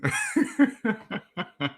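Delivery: high, soft real giggle trailing off; helpless quiet laughing fit; amused laughter. Real, not acted. Laughs at the chat comments.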